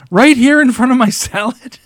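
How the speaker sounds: high, loud mock indignation; mock outrage. Real, not acted. Friend eating salad next to him, mock outraged. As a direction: play up the shock at him eating it.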